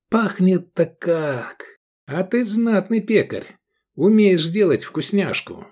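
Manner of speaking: high-pitched mock delight; exaggerated rapture. A narrator's reading. Diner praising the cook, exaggerated rapture. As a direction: gush with put-on ecstasy. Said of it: "в нарочитом восторге".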